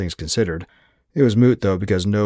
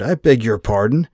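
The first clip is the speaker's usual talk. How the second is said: spluttering indignation; 3 semitones higher, 5 dB louder than usual. Acted. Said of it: "Anders spluttered".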